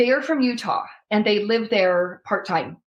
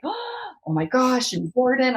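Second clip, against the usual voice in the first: gasping parents; 5 semitones higher than usual. Retold or imitated. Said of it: "They were like".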